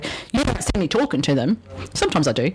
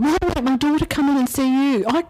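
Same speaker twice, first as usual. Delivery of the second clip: higher-pitched, insistent retold plea; pleading father; 7 semitones higher than usual. Dead father begging psychic to fetch his daughter, pleading. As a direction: imitate a spirit pressing to be heard.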